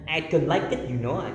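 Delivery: clunky Indonesian-accented I don't like it; heavy local accent. Shown as a demonstration. Showing students an unpleasant-sounding local accent. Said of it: "Kayak gitu kan gak enak ya".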